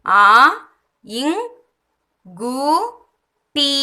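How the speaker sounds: "ஆ, இங், கு, டி" with a long pause after each; sound by sound. Shown as a demonstration. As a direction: break the word into separate sounds. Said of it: "இந்த லெட்டரை தான் இங்க pronounce".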